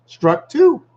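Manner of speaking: playful high-pitched "Struck two"; pisnąć wysoko, zabawnie; squeaky high. Shown as a demonstration.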